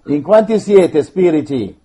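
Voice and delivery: loud, demanding questions; stern command. Real, not acted. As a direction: interrogate the spirits. Priest commanding the spirits.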